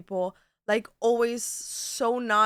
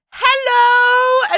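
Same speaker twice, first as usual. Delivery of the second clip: hammed-up shouted greeting; eager loud hello; 15 dB louder than usual. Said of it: "you're like".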